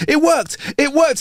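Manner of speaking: high, overjoyed shout; excited exclaim. Acted. Guy runs into the bar overjoyed, shouting high. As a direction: burst in like you won the lottery. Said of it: "he exclaims".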